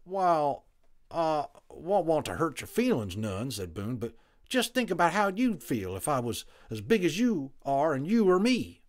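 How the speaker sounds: high, loud folksy cowboy voice; a blunt frontiersman making his point; Boone the cowboy. A narrator's reading. Narrator holds Boone's voice.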